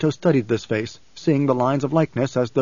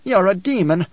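higher-pitched, mildly fearful youth; quavering; 6 semitones higher, 3 dB louder than usual. A narrator's reading. Youth to Leto as a demon, mildly quavering. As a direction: accuse with a touch of fear, voice raised. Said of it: "the youth quavered".

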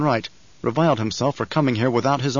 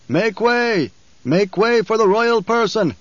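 herald's cry; 9 semitones higher, 3 dB louder, slower than usual. Acted. Herald announces the Emperor.

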